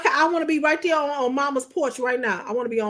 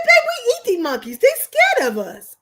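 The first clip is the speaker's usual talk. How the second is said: higher, louder put-on husband voice; husband's voice; 10 semitones higher, 5 dB louder than usual. Wife imitating husband explaining they eat monkeys. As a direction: play him calm and amused.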